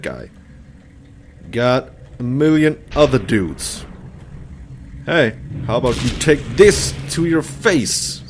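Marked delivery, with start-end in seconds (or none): none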